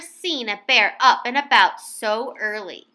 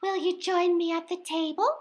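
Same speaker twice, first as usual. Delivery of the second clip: soft, higher invitation; gentle invitation; 6 semitones higher, 5 dB quieter than usual. A narrator's reading. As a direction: invite him tenderly. Missus Bear voiced.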